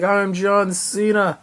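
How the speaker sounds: slow, drawn-out mock wrestler intro; a touch of hammed-up Cena; John Cena impression. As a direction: announce yourself like a ring entrance, lightly. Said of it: "He's like".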